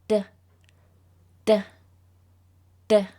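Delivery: short puffed d sound; d sound demo. Shown as a demonstration. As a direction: push a little puff of air on each d. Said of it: "like this".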